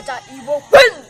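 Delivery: loud call to the crowd; rallying shout. Real, not acted. Elder waking the crowd before his talk, loud shout. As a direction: rouse them before speaking.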